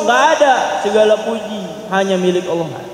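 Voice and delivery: ordinary delivery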